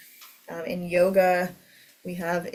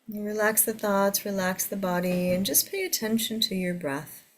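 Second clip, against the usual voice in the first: guided meditation. Acted. Leads a meditation.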